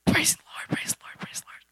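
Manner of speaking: whispered, fading away to nothing; half-hearted whisper. Shown as a demonstration. Teen showing her half-hearted worship, whispered and fading. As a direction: mumble it without any heart. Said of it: "But it wasn't like".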